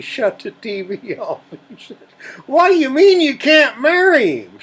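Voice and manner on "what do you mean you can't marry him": high, loud outraged dad; shocked father shouting. Acted. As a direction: yell it in disbelief. Father shocked at daughter's news.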